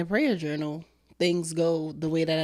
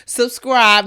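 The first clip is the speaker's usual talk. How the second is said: loud shout; 5 semitones higher, 10 dB louder than usual. Real, not acted. Calling viewers to subscribe.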